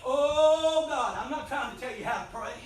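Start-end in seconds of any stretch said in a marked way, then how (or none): 0.0-1.2 higher, louder crying-out oh God; wailing prayer. Shown as a demonstration. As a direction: cry it out like at the altar. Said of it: "I'm just telling you the sounds".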